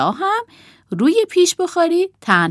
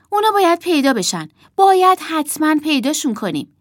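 put-on high, urgent girl's voice; insistent child; 8 semitones higher, 5 dB louder, faster than usual. A narrator's reading. Emma says puppets must be found, urgent and insistent. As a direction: insist on a search.

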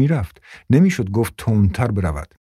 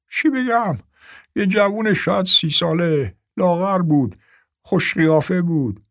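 put-on high, fretful old man; 8 semitones higher than usual. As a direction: describe him like a nervous old man. Old man describes the buyer.

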